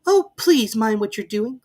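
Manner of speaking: put-on high, loud, frightened girl; terrified cry. A narrator's reading. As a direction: plead in panic. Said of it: "cried Alice".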